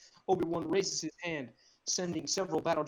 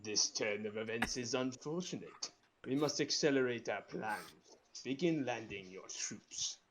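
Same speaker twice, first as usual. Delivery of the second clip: low, slow menacing Sith lord; cold command from the shadows; Darth Sidious; 4 semitones lower, 5 dB quieter than usual. Acted. Sidious voice held.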